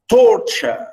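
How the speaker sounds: "torture" with a high, strong first syllable; stress on first syllable. Shown as a demonstration. Teacher showing English pronunciation, "torture" stressed on first syllable. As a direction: punch the start of "torture", let the end fall. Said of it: "we stress the first one".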